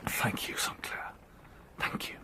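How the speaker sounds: soft unvoiced thank you; whispered thanks. Grateful friend whispering thanks for his loyalty. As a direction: let the gratitude come out in a whisper.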